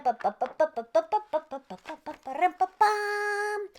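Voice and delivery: ordinary delivery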